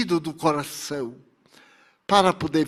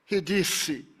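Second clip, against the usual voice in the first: ordinary delivery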